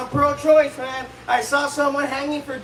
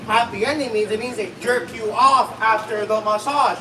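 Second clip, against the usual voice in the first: normal talking voice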